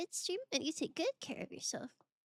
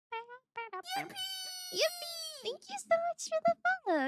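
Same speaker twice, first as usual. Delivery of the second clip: much higher, fast squealed thanks; high squeaky cheer; 3 dB louder than usual. Real, not acted. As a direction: squeal with joy. Celebrating a new follower.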